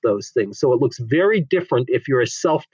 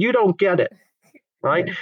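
high, emphatic and defiant; high mock-defiant insistence; insistent retort; 6 semitones higher than usual. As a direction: push back, certain you're right. Woman insisting on doing whatever.

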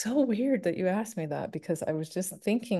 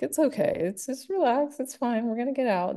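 reassuring; 7 semitones higher than usual. Retold or imitated. Calming panicked co-star in box.

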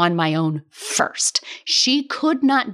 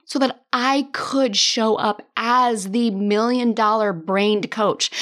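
ordinary talk

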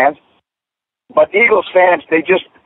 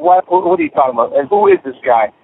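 put-on puzzled kid. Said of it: "looking at his father like".

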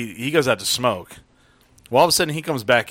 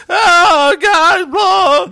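put-on drunk yell; 11 dB louder, much slower than usual. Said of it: "Full level voice, as loud as he can".

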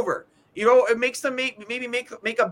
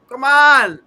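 loud, pleading outburst; exasperated shout; 4 semitones higher, 9 dB louder than usual. Retold or imitated. Fed-up fan to his hockey team, exasperated shout. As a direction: burst out in frustration, voice up.

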